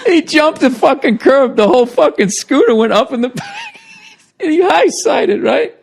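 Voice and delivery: high, laugh-filled telling; can barely get it out for laughing; laughing hard. Real, not acted. To a friend, recounting the crash while cracking up. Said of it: "Why are you laughing so hard?".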